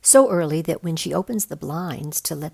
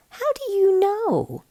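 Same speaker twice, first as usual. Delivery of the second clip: put-on much higher, slow doubtful kid; 5 dB louder than usual. A narrator's reading. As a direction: skeptical child asking.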